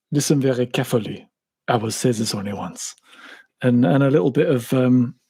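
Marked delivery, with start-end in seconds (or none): 0.1-2.9 a hushed, menacing 'Allo 'Allo German; lower, softer sitcom German villain; Herr Flick impression. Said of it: "a Herr Flick impression".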